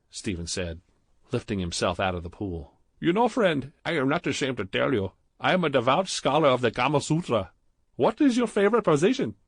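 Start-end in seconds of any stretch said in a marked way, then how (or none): 3.0-9.4 put-on higher, louder, heavy Indian accent; thick Indian accent. A narrator's reading. Clerk Badrul flirting with Stephen in the jacuzzi, thick Indian accent.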